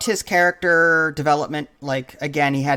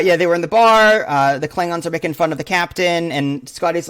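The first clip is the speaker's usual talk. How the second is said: ordinary talk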